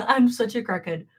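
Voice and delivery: ordinary talk, nothing marked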